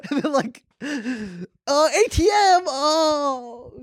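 high, squealing mock panic; hammed-up panic. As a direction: shriek it like a hacked joyride. Hammed-up hacked-car panic.

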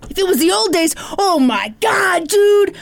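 hammed-up outburst, stretching the exclamation; mock exasperation. Ranter recalling his old days, mock exasperated. Acted rant about the dispute.

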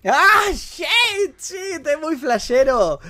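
high, loud burst of astonishment; amazed outburst. Real, not acted. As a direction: shout it out in delighted shock. Reacts amazed to the played clip.

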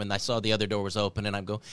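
usual speaking voice

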